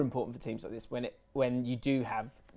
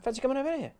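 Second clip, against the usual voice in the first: mock high invitation; 11 semitones higher, much faster than usual. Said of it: "gave me a bell".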